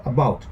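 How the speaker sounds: "about" with the weight on BOUT; stress on second syllable. Shown as a demonstration. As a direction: lean on the long "ou" in "about". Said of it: "the second syllable is more prominent".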